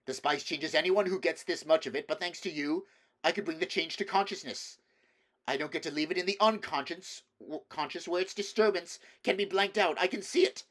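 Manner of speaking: a visionary son losing control; edge of madness. A narrator's reading. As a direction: let a manic certainty take over. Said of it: "She heard madness in his voice".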